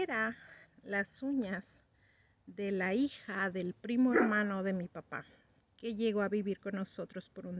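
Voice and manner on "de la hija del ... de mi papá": ordinary talk, nothing marked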